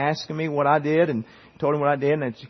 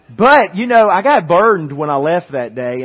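much louder and higher opening; preaching emphasis; 4 semitones higher, 9 dB louder than usual. Real, not acted. Pastor to congregation, loud burst of preaching. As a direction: punch it out to the pews.

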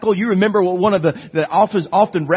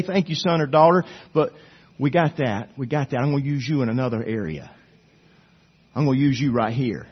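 soft, gentle and reassuring, a touch quieter; kind gracious voice; 4 semitones lower, 5 dB quieter than usual. Acted. The Spirit of God gently turning down a volunteer, in a kind gracious voice. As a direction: let them down tenderly, like a kind father. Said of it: "in His kind, just gracious voice".

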